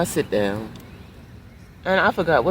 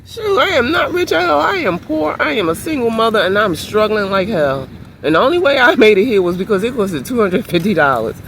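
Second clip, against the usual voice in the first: high, loud protest, pitched well above her usual voice; protesting loudly; 4 semitones higher, 5 dB louder than usual. Retold or imitated. Retelling her protest to the vendor, high and loud. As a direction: loudly insist you're not rich at all. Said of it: "I said".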